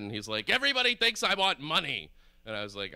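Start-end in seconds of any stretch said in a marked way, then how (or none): 0.5-2.1 high, loud, aggrieved outburst; mock angry yell. Stranger in Manchester to man walking away, really mad. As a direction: imitate a stranger blowing up. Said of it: "he gets really mad".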